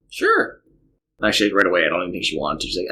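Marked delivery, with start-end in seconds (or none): 0.1-0.6 put-on little girl voice. Dad voices young daughter.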